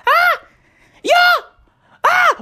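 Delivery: high, loud imitated party screams; mock party scream.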